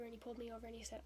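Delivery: usual speaking voice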